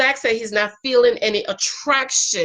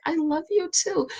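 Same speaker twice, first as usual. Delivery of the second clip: higher, sweet loving reply; 7 semitones higher than usual. Retold or imitated. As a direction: answer him sweetly. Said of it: "And I'm like".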